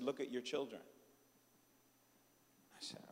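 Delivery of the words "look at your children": soft, low voice of the Holy Spirit; gently correct, hushed and low; quiet divine correction. Retold or imitated.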